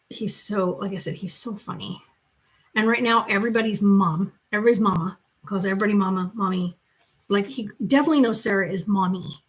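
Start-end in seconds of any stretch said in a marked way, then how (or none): none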